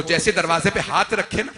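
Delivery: ordinary talk, nothing marked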